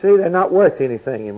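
usual speaking voice

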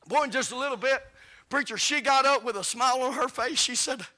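high, loud revival preaching; shouting preacher at a revival; full-force preaching. Acted. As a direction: lift the room, preach it hard.

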